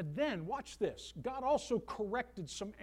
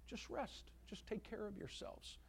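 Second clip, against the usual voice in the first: soft; 2 semitones higher, 11 dB quieter than usual. Real, not acted. Tender pastoral aside.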